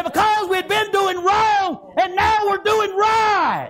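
high, loud, slow preaching cry; preacher shouting a convert's words; shouted quote. Acted. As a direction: shout the man's joy to the church. Quoted man, shouted in sermon.

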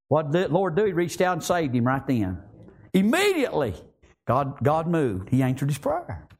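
ordinary talk

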